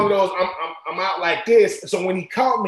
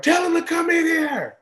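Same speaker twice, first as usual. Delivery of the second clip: retold screaming; 11 semitones higher than usual. Head coach yelling in the background of a call, furious shout. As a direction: bellow it like a coach losing his temper. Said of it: "So I can hear the screaming".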